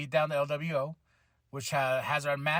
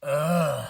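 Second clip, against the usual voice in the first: imitated drawn-out groan; Michael Cole; 2 semitones lower, 4 dB louder than usual. Commentator reacting as Austin Theory comes out, imitated groan. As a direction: let out a mock groan. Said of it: "Michael Cole's like".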